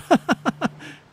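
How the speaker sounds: a high burst of laughter at the cold water; surprised laugh. Real, not acted. Scene: player swims into brisk water, bursting into high laughter. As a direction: let a sudden high laugh out.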